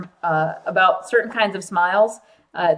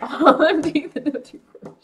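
laughing while quoting; laughing imitation; 5 semitones higher, 3 dB louder than usual. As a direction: repeat it through laughter. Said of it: "I like when he says that".

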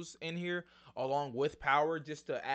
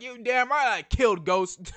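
raised, louder mock satisfaction; Paz; 6 semitones higher, 6 dB louder than usual. Host imitating Paz's look over killing Ghost, mock satisfied. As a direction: own it with hammed-up, defiant satisfaction. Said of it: "she looks satisfied".

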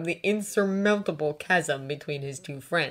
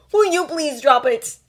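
loud, raised, irritated plea; exasperated groan; 9 semitones higher, 9 dB louder than usual. A narrator's reading. Draco shutting down the Ron topic, exasperated. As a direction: beg crossly to end it. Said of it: "Draco groaned, glowering".

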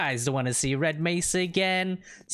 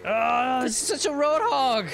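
high, raised-voice groaning protest; exasperated complaint; 9 semitones higher, 4 dB louder than usual. Real, not acted. Player to road-hog partner, exasperated. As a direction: wail about the reckless driver. Grumbles at partner's driving, then laughs.

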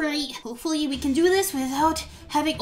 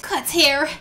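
ordinary talk

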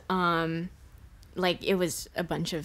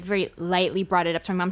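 ordinary delivery

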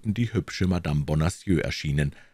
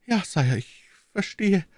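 stammering; 14 semitones higher than usual. A narrator's reading. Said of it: "stammelte die Königin".

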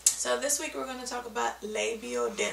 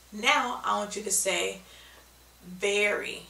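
usual speaking voice

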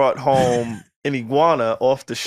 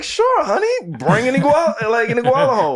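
indulgent mom voice; 7 semitones higher than usual. Retold or imitated.

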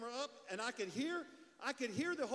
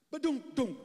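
booming mouth sound of a body hitting metal; comic crash noise, much louder; loud thud sound effect; 5 semitones higher, 11 dB louder than usual. Retold or imitated. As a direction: slam out a loud ba-doom like a crash. Cop falls onto car trunk.

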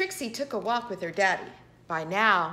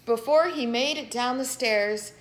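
usual speaking voice

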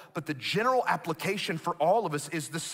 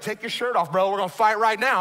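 mock fighting words; 2 semitones higher, 5 dB louder than usual. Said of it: "those are fighting words".

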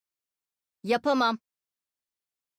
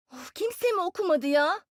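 higher-pitched, put-out whine; huff, then complain with pitch raised; exasperated complaint; 6 semitones higher than usual. Acted. Kimse raporunu okumamış, yakınıyor. (sitemli, tiz yakınma)

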